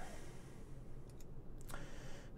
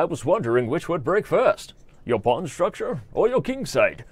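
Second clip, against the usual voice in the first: higher, lilting villain voice; Bane; 4 semitones higher than usual. Acted. Movie villain quizzing an opponent on chess openings, theatrical lilt. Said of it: "any word on the Bane teaches".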